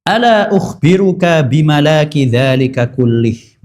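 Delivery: measured, slow, reverent reading of the Arabic; slow Arabic hadith recitation. Acted. Preacher recites the hadith to the congregation, slow and reverent. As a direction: recite the hadith slowly and solemnly.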